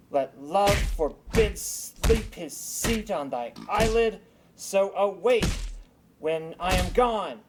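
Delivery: incanting a spell. Acted. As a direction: cast the charm over the sleeper with ceremony. Puck casts a love charm.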